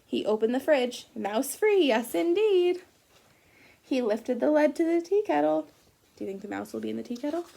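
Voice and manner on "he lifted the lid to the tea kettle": ordinary delivery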